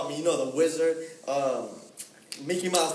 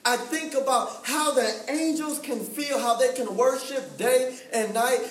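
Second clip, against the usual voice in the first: higher-pitched, insistent sermon delivery; fervent preaching; 5 semitones higher than usual. Real, not acted. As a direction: drive each phrase home in a rising refrain. Preaches on angels worshiping God.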